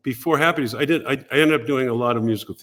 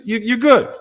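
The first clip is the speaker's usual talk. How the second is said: much higher, louder Gary; imitating Gary; 11 semitones higher, 6 dB louder than usual. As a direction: blurt it like an impressed boss, up high. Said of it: "He goes".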